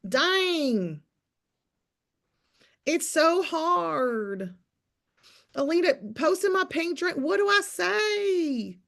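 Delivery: high, drawn-out whiny mock voice; mock the complainers in a high whine; lightly whiny mimic of a struggling poster. Mocking whiny reel posters.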